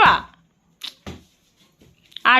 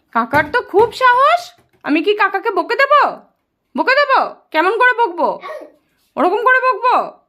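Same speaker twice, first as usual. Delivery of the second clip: playful mock threat; 6 semitones higher than usual. Playful threat to scold uncle.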